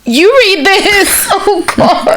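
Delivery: high, slow and teary, laughing through it; a crack of real emotion behind the laugh; teary half-laugh. Real, not acted. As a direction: hand it off while fighting back tears. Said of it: "you're gonna make me cry".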